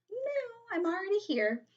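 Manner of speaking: very high sing-out "no"; refusing little girl. Acted. Retold little girl refusing the seat.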